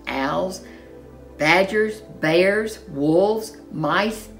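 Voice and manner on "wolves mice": ordinary delivery, nothing marked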